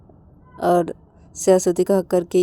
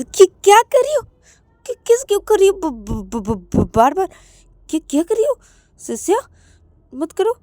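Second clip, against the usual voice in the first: high, loud stammering protest; embarrassed, pushing the kisses away; flustered protest; 11 semitones higher, 5 dB louder than usual. Acted. Kissed again and again, she protests high and flustered. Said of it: "तो तभी श्रुति".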